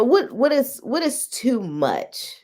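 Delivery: ordinary talk, nothing marked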